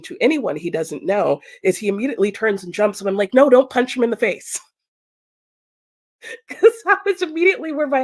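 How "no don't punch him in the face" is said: louder, urgent warning; to husband, urgent loud warning; urgent shout. Retold or imitated. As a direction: stop him before he swings. Stopping husband.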